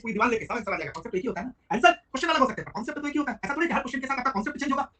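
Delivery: ordinary talk, nothing marked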